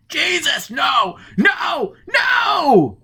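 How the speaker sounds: very high, loud terrified scream; hysterical screaming. Acted. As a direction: scream in blind panic. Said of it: "Forrest began to scream hysterically".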